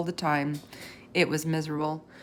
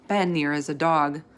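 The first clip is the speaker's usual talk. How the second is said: ordinary delivery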